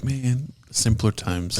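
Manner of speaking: slow, soft nostalgic sigh; wistful sigh. Retold or imitated. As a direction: drift off wistfully. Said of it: "you're like".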